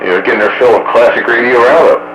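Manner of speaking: ordinary delivery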